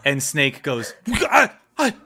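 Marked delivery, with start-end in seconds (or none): 1.1-1.9 high, loud panicked pleading; stammer, then shout a desperate apology; mounting panic into a full shout; panicked loss of cool. Acted. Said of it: "he loses his cool".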